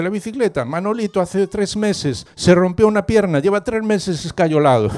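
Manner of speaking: high-pitched wailing boy, acted; mock tearful complaint. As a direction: complain like an upset child, lightly hammed-up. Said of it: "Y no dejaba de llorar". (queja de niño llorón, fingida)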